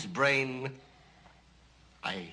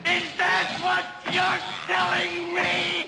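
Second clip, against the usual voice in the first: furious shout; 10 semitones higher, 5 dB louder than usual. Acted. Doctor screaming at the assistant over the brain: furious shout. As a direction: explode with rage at the blunder.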